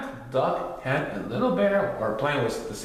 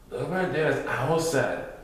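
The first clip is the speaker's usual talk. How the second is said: plain reading voice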